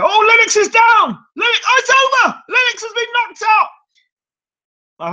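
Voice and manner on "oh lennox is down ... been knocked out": very high shouted commentary; radio commentator shouting. Retold or imitated. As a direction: scream it like a ringside caller. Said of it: "And I remember hearing".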